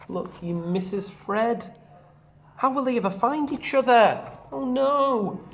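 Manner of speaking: ordinary delivery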